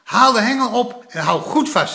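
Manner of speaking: excited fishing-grandpa shout; a bit excited. A narrator's reading. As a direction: grandpa hurrying the boy on. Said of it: "zei opa een beetje opgewonden".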